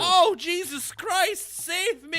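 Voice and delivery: pained shout. Real, not acted. Burned hand on hot dog.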